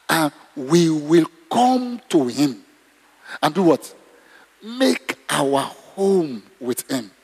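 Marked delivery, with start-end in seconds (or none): 0.1-2.6 each word drawn out for emphasis; deliberate, measured scripture reading; slow, weighty reading. Real, not acted. Preacher reads the promise with weight. 4.6-7.1 each word drawn out for emphasis; deliberate, measured scripture reading; slow, weighty reading. Real, not acted. Preacher reads the promise with weight.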